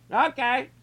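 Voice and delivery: high, short acknowledgement; calling back. Real, not acted. Host answering someone off-mic, calling back high. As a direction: sing out a quick high reply.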